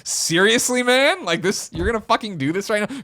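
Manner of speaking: high-pitched mock outrage at someone's nerve; indignant disbelief. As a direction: imitate a friend's incredulous telling-off. Imitates friend confronting noisy eater.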